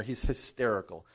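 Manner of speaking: ordinary talk, nothing marked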